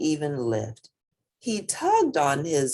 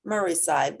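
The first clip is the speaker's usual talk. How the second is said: plain reading voice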